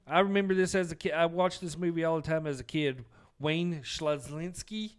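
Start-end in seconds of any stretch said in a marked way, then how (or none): 3.4-4.9 slow, careful go at "Szlazinski"; tentative pronunciation. Real, not acted. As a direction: sound out "Szlazinski" slowly, unsure of the surname. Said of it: "try to pronounce this last name".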